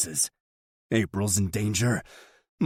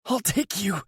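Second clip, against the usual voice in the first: gasping offer; 13 semitones higher than usual. Acted. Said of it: "Yuri gasps".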